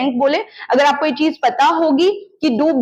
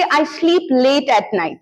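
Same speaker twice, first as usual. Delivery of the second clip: I sleep late at night said very slowly; slow classroom demo; slow model sentence; much slower than usual. Shown as a demonstration. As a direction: spell it out slowly for learners. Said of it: "मैं सीधे बोल दूंगी".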